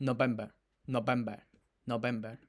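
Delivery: "November" echoed with a dropped, drawn-in ending; mock-accented "November", said as a short echo; mimicked accent. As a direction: repeat "November" mimicking her put-on accent. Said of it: "otro acento de Kamala".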